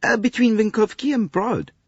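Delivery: high, exasperated French conductor; a harried Frenchman pouring out his troubles. Acted. Conductor complains to Poirot about the American lady, exasperated.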